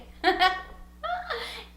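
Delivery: high burst of laughter. Real, not acted. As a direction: laugh at yourself. Laughs at her own accent.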